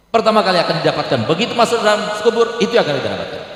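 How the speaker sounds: fiery sermon warning; preaching at full force. Acted. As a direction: thunder it from the pulpit, pushing the pace. Preacher raises voice on grave warning.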